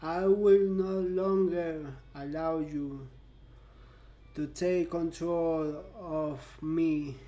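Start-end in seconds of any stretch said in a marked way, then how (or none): none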